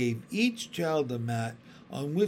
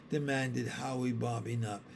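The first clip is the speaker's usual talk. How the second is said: usual speaking voice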